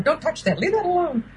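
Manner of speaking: high, fussy don't-touch voice; fussy adult. Retold or imitated. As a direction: scold like a childless grown-up. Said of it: "Typical of childless adult".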